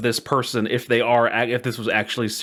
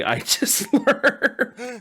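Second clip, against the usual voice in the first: high laugh breaking through slow words; can't hold it together, laughing; 11 semitones higher, much slower than usual. Real, not acted. Host laughing at the band's timeline, high laughing.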